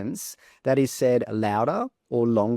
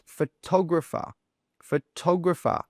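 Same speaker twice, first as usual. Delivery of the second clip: "photographer" with TOG louder and stronger; stress on second syllable; 5 semitones higher than usual. Shown as a demonstration. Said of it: "tog sound is pronounced a little bit louder".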